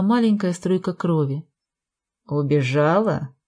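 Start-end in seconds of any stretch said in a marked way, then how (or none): 2.3-3.3 low, slow hoarse rasp; hoarse croak. Acted. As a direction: croak it, wounded and sneering. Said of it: "прохрипел он".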